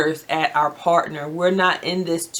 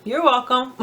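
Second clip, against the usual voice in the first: higher-pitched, playful prompt with a laugh behind it; lightly teasing "you're welcome"; pointed manners reminder; 6 semitones higher than usual. Retold or imitated. Children nudging dad about his forgotten manners, playful pointed reminder. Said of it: "my children now will say".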